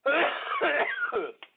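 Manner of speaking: sudden hard coughing; choking cough on a drink; coughing fit. Real, not acted. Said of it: "That just went down the wrong pipe".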